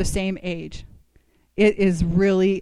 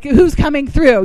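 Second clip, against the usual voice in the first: drawn-out rallying call, higher and louder; urgent appeal; 4 semitones higher, 7 dB louder than usual. Retold or imitated. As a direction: call out slowly for volunteers. Acted urgent appeal for help.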